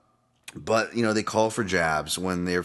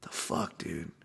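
whispered, softer what-the-hell; whispered disbelief; 3 semitones lower, 10 dB quieter, slower than usual. Retold or imitated. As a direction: breathe it out in disbelief. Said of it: "And you're like".

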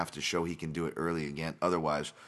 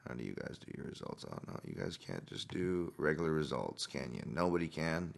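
muttered grumbling; 3 semitones lower, 9 dB quieter than usual. Real, not acted. Grumbles while hunting for results.

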